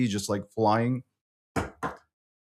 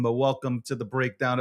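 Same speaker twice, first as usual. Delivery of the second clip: ordinary talk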